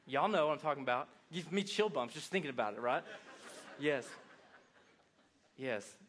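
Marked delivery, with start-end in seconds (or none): none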